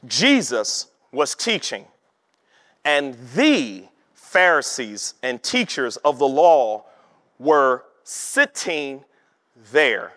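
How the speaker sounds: slow, weighted "Jesus was teaching", pausing before "were sitting" and "there"; emphatic reading. Shown as a demonstration. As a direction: stretch "the", "sitting" and "there", each phrase set apart. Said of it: "read it with the emphasis that's on it".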